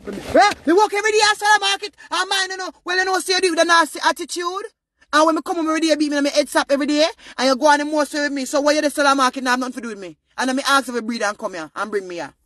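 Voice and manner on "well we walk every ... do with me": put-on loud, high, hurt grown child airing old grievances; hurt child confronting parent. Grown child confronting the mother who beat her, raised angry voice. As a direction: let it spill out like years of hurt.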